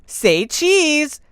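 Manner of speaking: bright call for a snapshot; photo cheer. Acted.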